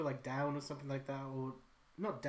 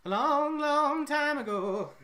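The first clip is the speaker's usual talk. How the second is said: high, loud, slow dramatic storyteller voice; mock epic storyteller; 12 semitones higher, 10 dB louder, much slower than usual. Recalling an old drawing, a slow hammed-up storyteller line. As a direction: stretch it out like a fairy tale opening.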